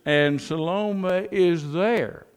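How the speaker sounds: drawn out Salome is there, falling at the end; slow emphatic refrain. Acted. Preacher closes the refrain to congregation, drawn out slowly. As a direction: linger on each word of the refrain.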